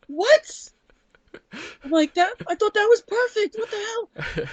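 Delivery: very high mock outrage with a laugh; hammed-up baffled squeal; retold shock. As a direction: squeak your own disbelief, laughing. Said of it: "And I was like".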